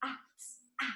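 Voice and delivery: isolated, very soft sounds around "A, a"; "A, a" soft and separate, as single sounds; sounded out. Shown as a demonstration. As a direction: sound out "A, a" as separate tiny phonemes. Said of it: "a palavra nos sons".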